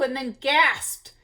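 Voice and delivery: usual speaking voice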